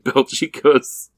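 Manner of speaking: usual speaking voice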